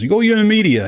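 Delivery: loud, high Southern fan impression; mock Southern fan. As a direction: ham up the Louisiana guy repeating you. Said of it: "And he goes".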